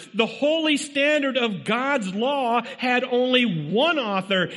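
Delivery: high-pitched, driving sermon delivery; urgent pulpit exhortation; impassioned preaching. Real, not acted. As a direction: preach it hard, pressing each point on the congregation. Preaches at full force.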